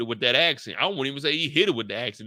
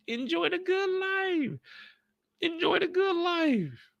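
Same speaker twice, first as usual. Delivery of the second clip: dreamy advice; 4 dB quieter, slower than usual. Retold or imitated. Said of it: "All he gotta do is have her".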